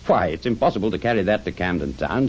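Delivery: ordinary delivery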